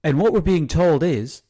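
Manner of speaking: loud, high news anchor voice; hammed-up TV reporter delivery. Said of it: "you, you sound like a reporter".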